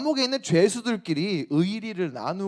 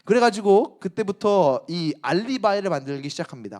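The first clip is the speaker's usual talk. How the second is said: ordinary talk, nothing marked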